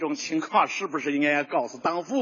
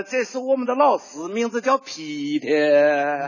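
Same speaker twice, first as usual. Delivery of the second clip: Sichuan-style Peter drawn out very slowly; slow, lilting Sichuan reading of 名字叫Peter; Sichuan accent; 3 semitones lower, slower than usual. Shown as a demonstration. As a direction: Sichuan accent, each word stretched long. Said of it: "这四川话好听啊".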